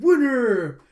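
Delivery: loud, high victory call; triumphant. Retold or imitated. Card winner announces her own victory, triumphant. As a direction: belt it out as a champion.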